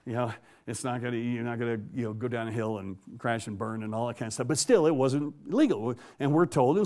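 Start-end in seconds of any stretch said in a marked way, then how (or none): none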